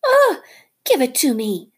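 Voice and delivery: very high, loud cry then pleading; desperate cobra. Acted. Nagina begging Rikki for her last egg. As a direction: beg for the egg.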